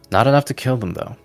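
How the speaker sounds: usual speaking voice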